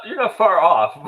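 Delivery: loud, high, through laughter; laughing confirmation. Real, not acted. Co-host confirms friend's guess about the cat, laughing. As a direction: burst out laughing as you say it.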